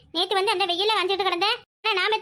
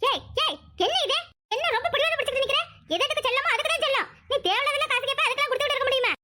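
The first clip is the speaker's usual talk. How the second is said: higher-pitched exasperated telling-off; scolding; 6 semitones higher than usual. Acted. Mother refusing her son cake money, scolding. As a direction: scold a stubborn child.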